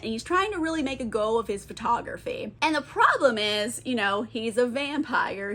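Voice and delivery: ordinary talk, nothing marked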